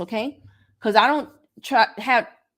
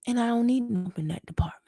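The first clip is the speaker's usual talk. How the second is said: whispered aside; 3 dB quieter than usual. Real, not acted. Aside about annoying prospects.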